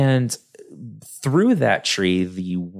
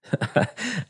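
high giggle; 13 semitones higher, 5 dB quieter than usual. Real, not acted. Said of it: "I'm sorry".

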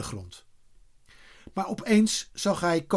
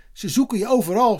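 high, louder shout; 8 semitones higher, 6 dB louder, faster than usual. A narrator's reading. Kees tells Eddy he is wanted, shouting. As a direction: shout the urgent news to him. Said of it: "schreeuwde Kees".

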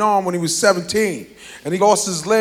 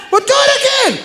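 high shouted mom order; mom yelling. Retold or imitated. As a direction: yell the order from the back.

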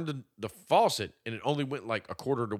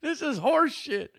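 high, slow, whining; whiny mock complaint; 10 semitones higher, slower than usual. Friend mocking the cold bath, high slow whine. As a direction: whine it like a sulking kid.

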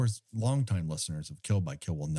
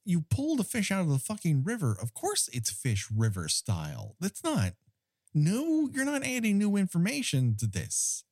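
high-pitched incredulous rant; exasperated objection; 8 semitones higher than usual. Acted. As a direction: protest the pointless line in comic exasperation. Mock argument with the film's character.

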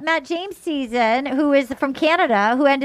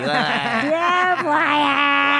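lightly voiced dreamy contestant; spaced-out impression. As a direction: drift through it like on pills. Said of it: "like a Jennifer Coolidge character".

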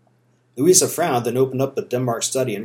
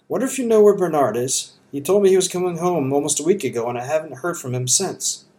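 put-on worried mother, light Southern accent, higher pitch; a Georgia mother asking anxiously about her son; 5 semitones higher than usual. A narrator's reading. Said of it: "never polite Southern accent".

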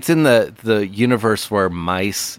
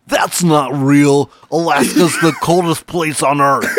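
mock dipshit; 6 semitones higher, 5 dB louder than usual. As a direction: bluster like a clueless know-it-all. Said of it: "been like".

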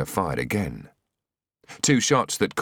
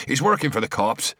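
raised alarmed shout; 7 semitones higher than usual. A narrator's reading. Ryan shouting to the others about O'Leary. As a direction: shout it out in shock. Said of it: "shouted Ryan".

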